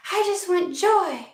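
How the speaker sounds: high, drawn-out wishing; mock plea. Querent putting a vague wish out to the universe, mock plea. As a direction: whine it slowly to the universe.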